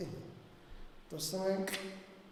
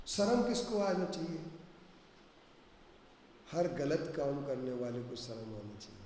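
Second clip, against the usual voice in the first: normal talking voice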